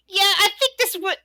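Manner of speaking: high-pitched character voice; second kobold guard. Acted. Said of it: "one of the other ones looks at the other and says".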